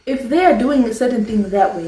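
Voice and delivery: ordinary talk, nothing marked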